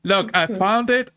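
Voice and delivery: ordinary talk, nothing marked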